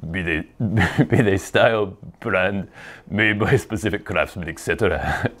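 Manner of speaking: put-on halting, half-hearted Scottish accent; failed Scottish accent. Host giving up on a supporter's request, broken Scottish accent attempt. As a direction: stumble through a Scottish accent, amused. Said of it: "I can't do it".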